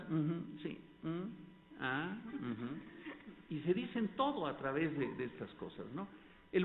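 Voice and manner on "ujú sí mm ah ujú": soft, slow mock uju and mm; drawn-out lovesick murmuring, imitated; teen phone murmurs. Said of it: "una pareja de novios adolescentes hablar por teléfono". (murmullos lentos de novio adolescente)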